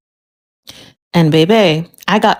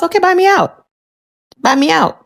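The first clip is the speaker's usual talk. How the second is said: taunting challenge; 10 semitones higher than usual. Retold or imitated. Challenging husband, high.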